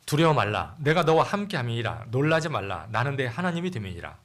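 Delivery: loud, solemn Bible reading; scripture reading. Acted. Pastor reading the storm passage aloud, solemn and loud. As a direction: proclaim the verses to the congregation.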